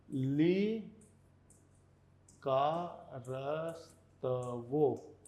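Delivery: लिकारस्तवो very slow, every syllable stretched and apart. Shown as a demonstration. Teacher writes out the word for medicine, लिकारस्तवो syllable by slow syllable. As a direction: dictate लिकारस्तवो as lii, kaa, ras, ta, vo. Spelling out word while writing.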